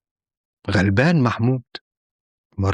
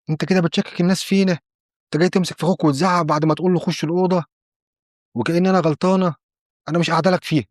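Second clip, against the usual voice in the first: higher-pitched wifely scolding; acted outrage in a raised pitch; indignant wife; 6 semitones higher, faster than usual. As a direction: accuse him and declare you are leaving. Wife quarrels and walks out.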